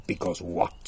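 low lion voice; demanding lion. Acted. Lion questioning the stalling mouse, low demand. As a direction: press for the answer, low.

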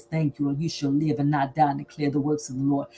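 ordinary talk, nothing marked

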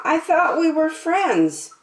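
high, small, hurt voice for the little dog; poor little Trevor. Acted. Trevor pleading with Pig after being blamed, high and hurt. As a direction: squeak it out like a wounded little pup. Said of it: "asked poor little Trevor".

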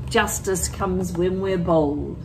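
normal talking voice

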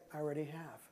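very soft, low reply; hushed, tender absolution; gentle forgiveness. Retold or imitated. Wife answering his repeated apology, gentle and soft. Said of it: "it was the gentleness of God".

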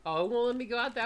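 ordinary talk, nothing marked

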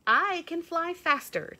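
high, loud birdy boast; chirping cardinal. A narrator's reading. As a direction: chirp it out brightly. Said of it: "chirped the cardinal".

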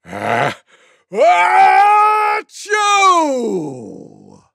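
drawn-out theatrical sneeze falling away; enormous mock sneeze. Tiger outdoing everyone, huge mock sneeze. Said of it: "gave the loudest sneeze you could ever imagine".

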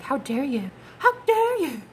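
a pearl-clutching how dare you that jumps high on the repeat; mock offended partner. Tarot reader mocking the offended lover storming off, shrill. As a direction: play the fragile ego, aghast and shrill. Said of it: "they say something like".

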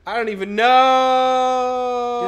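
slow, loud drawn-out cry. Acted. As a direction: stretch it out loudly. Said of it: "you wanna do the voice for Kate?".

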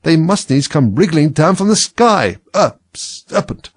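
contained storybook shriek, stretched at the end; rising to a shriek. A narrator's reading. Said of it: "raising its voice to a shriek".

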